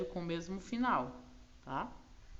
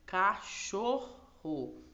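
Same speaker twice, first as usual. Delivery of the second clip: cachorro said slowly, broken into ca-chor-ro; separando as sílabas; 3 semitones higher, 4 dB louder, much slower than usual. Shown as a demonstration. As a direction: split "cachorro" into syllables, drawing out each. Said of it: "as palavras por sílabas". (bem devagar, sílaba por sílaba)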